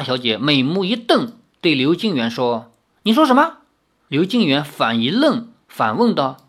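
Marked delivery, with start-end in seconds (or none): 3.0-3.6 high, loud sharp "what did you say"; indignant demand. Acted. Girl glaring at her suitor, high and indignant. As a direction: flare up at him.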